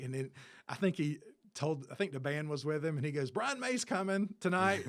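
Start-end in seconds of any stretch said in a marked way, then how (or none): 3.3-4.8 joking announcement. Retold or imitated. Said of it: "like, jokingly or whatever".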